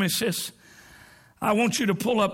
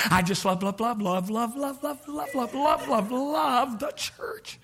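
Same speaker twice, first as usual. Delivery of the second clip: mocking churchgoer; 4 dB quieter, slower than usual. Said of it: "I hear people in the church".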